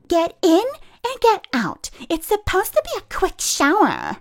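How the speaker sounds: imitating a pushy woman barking orders; mock shrill yelling lady. A woman yelling at a lady in the shower, mocking.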